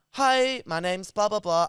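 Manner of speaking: high, bright-lilted hello, then trailing off; lightly camp operator greeting; camp phone operator. Retold or imitated. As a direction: mimic a camp call-centre guy.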